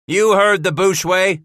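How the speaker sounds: high, loud holler to his men. Acted. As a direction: holler over the herd. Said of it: "Scratch hollered".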